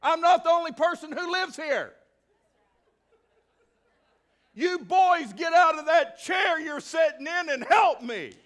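high, loud, exasperated shout; Angie calling for help. Retold or imitated. Wife Angie ordering the boys to clean, a loud shout. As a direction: yell for the boys to get up. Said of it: "Because Angie says".

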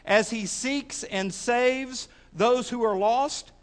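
loud, deliberate preaching with drawn-out phrases; emphatic preaching. Real, not acted. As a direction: press each phrase on the congregation. Preaches to congregation.

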